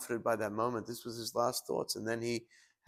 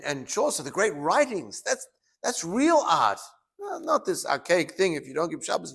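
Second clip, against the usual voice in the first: acting out an exasperated skeptic, raised pitch; exasperated dismissal; 6 semitones higher, 5 dB louder than usual. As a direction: protest loudly, dismissing it all as nonsense. Acted professor scornfully rejecting religion.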